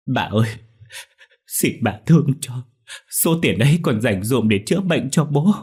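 high, loud begging; tearful pleading. A narrator's reading. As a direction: plead desperately for father's money. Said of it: "khóc lóc van xin".

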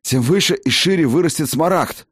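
high hoarse gasps on the run; hoarse while running. A narrator's reading. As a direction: rasp it out breathless mid-sprint. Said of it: "на бегу прохрипел лекарь".

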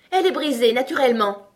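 high, loud exasperation; frantic angry cry. A narrator's reading. Tweedledum stamping over his broken rattle, angry. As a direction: cry it out in a tantrum. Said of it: "en se mettant à trépigner comme un fou".

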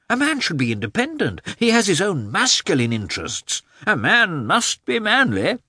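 high, teasing worldly voice; lecturing man of the world. A narrator's reading. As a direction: lecture him like an old hand. Oblonsky lecturing Lievin, raised voice.

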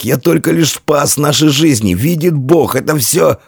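high, strained insistence; rasped protest. A narrator's reading. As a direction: insist through a hoarse throat. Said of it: "прохрипел десятник".

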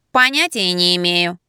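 slow, droning nasal disguise; nasal, pinched nose. A narrator's reading. Wife putting off a pestering caller, nasal. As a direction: drawl it through a pinched nose. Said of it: "Я зажала нос пальцем и прогундосила".

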